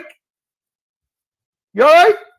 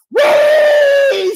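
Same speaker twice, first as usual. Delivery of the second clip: long, loud, high "weee"; mock pig squeal; 12 semitones higher, 5 dB louder than usual. Taunting a rival about a pig, long high squeal. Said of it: "Bud, squeal".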